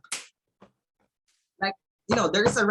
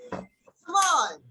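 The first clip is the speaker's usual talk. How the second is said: rallying call; 9 semitones higher, 5 dB louder than usual. Real, not acted. Teacher rallying students.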